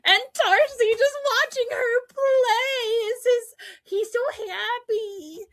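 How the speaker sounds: high, loud, overcome with delight; gushing squeal. Real, not acted. Fan describing Darcy watching her play, gushing. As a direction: gush in a high, stretched-out squeal.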